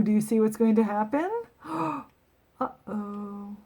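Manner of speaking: ordinary delivery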